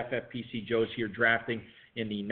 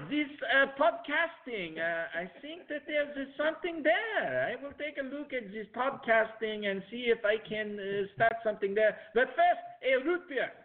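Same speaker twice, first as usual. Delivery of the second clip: Ludwig von Sprecher; 10 semitones higher than usual. Acted. Host playing the root beer founder to tease co-host, hammed-up German accent. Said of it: "if that was a German accent".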